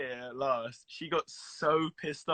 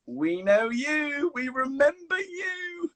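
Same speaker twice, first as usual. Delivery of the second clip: high, slow, gushing mimic of the parents; imitating his parents; 12 semitones higher, much slower than usual. His parents greeting him at the premiere, high slow gushing mimic. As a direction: coo it slowly like proud parents. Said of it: "was like".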